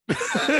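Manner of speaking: sudden shrill burst of laughter; high squealing laugh. Real, not acted. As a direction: crack up high and loud. Cracking up at own story.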